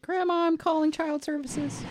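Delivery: put-on high little-boy voice; young boy voice. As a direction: whine it like a small kid. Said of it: "Chad as a young boy".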